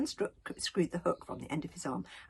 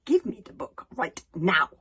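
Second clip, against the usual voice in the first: loud, firm command; 11 dB louder than usual. A narrator's reading. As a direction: order it sharply and loud. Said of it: "commanded Alvin".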